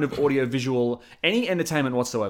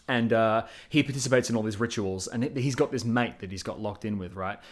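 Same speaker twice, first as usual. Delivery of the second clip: ordinary talk, nothing marked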